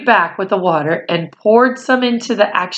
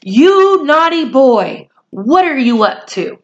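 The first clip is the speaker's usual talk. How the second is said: scolding mother; 7 semitones higher, 4 dB louder than usual. A narrator's reading. Mother catching her son out of bed, scolding him. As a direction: drag it out like a cross mother.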